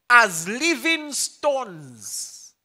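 slow, drawn-out "living stones" that sounds like Livingstone; stretched-out punning "as living stones"; drawn-out like tongues. Shown as a demonstration. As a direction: stretch "as living stones" so it rolls like the Victoria Falls. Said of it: "you sound like the Victoria Falls".

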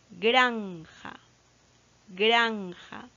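"granja" drawn out, GRAN held before "ja"; deliberate teacher's "GRAAN-ja"; stress on GRAN. Shown as a demonstration. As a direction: hold the first syllable of each "granja". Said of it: "la sílaba tónica está en gran".

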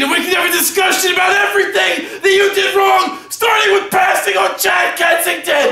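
high, shouted climax of a tirade; self-important actor in full rage; ranting. Acted. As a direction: bellow it as a parting threat. Tirade peaks as he leaves.

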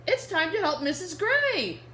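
put-on bright little-girl character read to kids. Reading Biscuit to children, the little girl cheerfully calls her puppy. Reading picture book aloud.

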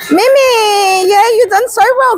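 excited praise. Real, not acted. Mum praises her daughter after the ride, high and gushing. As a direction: gush over her as she gets off.

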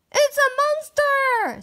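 high, loud panicked scream; terrified scream. Acted. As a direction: scream in terror at the monster. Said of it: "somebody screamed".

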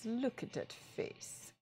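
slow, soft whisper. Shown as a demonstration. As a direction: breathe it out slowly. Repeated face, whispered.

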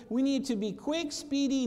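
ordinary talk

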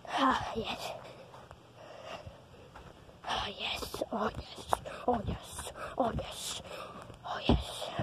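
breathy whispered mock delight; whispered ah yes. Kid whispering to himself in playful satisfaction, softly. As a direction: whisper it, savouring it.

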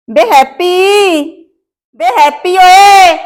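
mother hollering for her son, drawn out; calling out. Acted. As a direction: call the son from another room, stretching his name.

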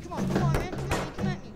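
high, loud egging-on; shouted challenge. Acted. Fighter egging on his opponent, shouted.

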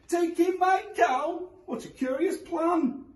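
put-on high, slow incredulous old woman; old lady voice. A narrator's reading. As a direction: drawl it like a shocked granny.

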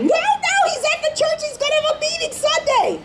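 put-on high, fast girl's protest; girl insists to the principal, high and quick; excited insisting child. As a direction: plead eagerly to be believed.

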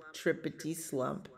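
ordinary delivery, nothing marked

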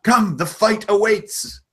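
high, slow goblin voice waving them on; urging the party forward; eager goblin. Acted. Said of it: "He's like".

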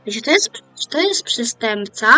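ordinary talk

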